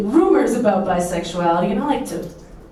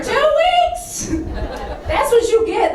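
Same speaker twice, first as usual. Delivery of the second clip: delighted squeal; 13 semitones higher, slower than usual. Real, not acted. Delighted comic punch.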